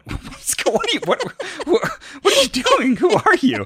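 high squeaky laughing what; laughing disbelief. Real, not acted. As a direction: laugh out a baffled who are you. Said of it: "laughing mock bafflement".

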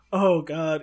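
ordinary talk, nothing marked

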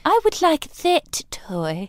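put-on slow, high posh English child voice; posh child begging for a toy, mock-plummy; posh little kid. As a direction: play a spoiled posh child asking. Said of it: "little kid being like".

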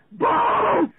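high, loud panicked yell of Balls; screamed demo. Shown as a demonstration. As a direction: belt it out like falling off a board. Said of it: "like this".

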